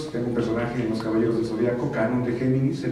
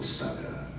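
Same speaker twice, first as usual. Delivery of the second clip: villain whisper; 10 dB quieter than usual. Retold or imitated. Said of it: "malo, duro y risa malvada".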